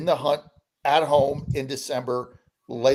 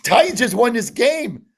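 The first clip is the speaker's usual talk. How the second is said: retold excited shout, louder and higher; excited news; 7 semitones higher, 7 dB louder than usual. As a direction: burst in with the big news. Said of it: "And they're like".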